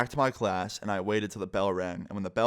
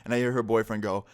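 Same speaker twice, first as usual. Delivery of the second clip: usual speaking voice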